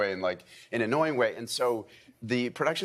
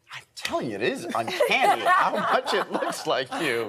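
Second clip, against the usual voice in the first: Patrick Duffy; 10 semitones higher, 4 dB louder than usual. Retold or imitated. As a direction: imitate someone insisting in wonder, laughing. Acts out Patrick insisting on the likeness.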